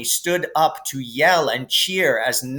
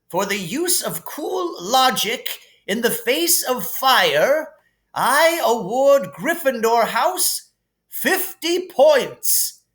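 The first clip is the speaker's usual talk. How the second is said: higher, grand headmaster voice; Dumbledore voice; 10 semitones higher, slower than usual. Acted. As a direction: hand out points with relish. Dumbledore awarding points.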